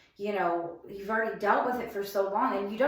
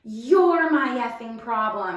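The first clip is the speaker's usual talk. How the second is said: 1 retold angry retort; 7 dB louder, slower than usual. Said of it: "And he said".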